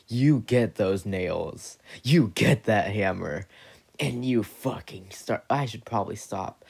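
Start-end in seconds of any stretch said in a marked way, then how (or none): 0.1-5.4 building intensity. Acted. Story builds to revenge on Jesus.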